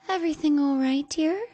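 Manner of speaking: sickly-sweet concern; eerie sweet mother. A narrator's reading. Mother waiting outside door.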